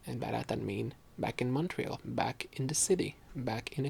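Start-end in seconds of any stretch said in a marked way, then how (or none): none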